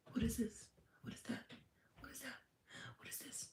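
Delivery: tiny, high, hushed questioning voice; mock questioning. Mimicking someone questioning every little thing, hushed and high. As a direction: fade to a near-whisper, puzzling over everything. Mocks endless questioning of the universe.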